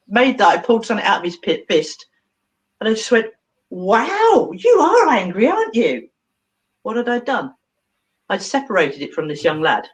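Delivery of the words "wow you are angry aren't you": high, emphatic wow of wonder; retold playful astonishment; mock amazement. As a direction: marvel at it as if to a creature. Addresses the boy's pulled-out anger.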